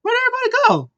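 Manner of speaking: very high, baffled squeak of a question; baffled cry. Retold or imitated. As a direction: shoot the pitch way up in disbelief. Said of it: "I was like".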